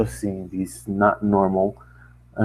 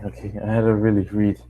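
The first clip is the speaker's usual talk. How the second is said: plain reading voice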